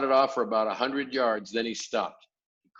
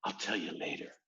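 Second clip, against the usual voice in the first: hushed; 3 semitones lower, 8 dB quieter than usual. A narrator's reading. Sophie hushed reply.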